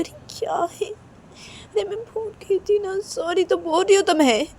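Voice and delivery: very high, drawn-out whine; whiny protest. Acted. Reshma repeats sorry to angry Kajal, whiny pleading. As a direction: plead in a thin, whispery high voice.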